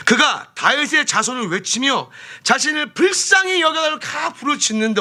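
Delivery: high, impassioned pulpit delivery; forceful preaching. Real, not acted. Preacher retelling the blind man's plea, high and impassioned. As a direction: preach it out as an urgent cry.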